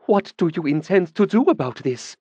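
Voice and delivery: high, sharp demand; a hint of a demanding noblewoman; a woman demanding the mage act on the theft. A narrator's reading. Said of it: "she demanded".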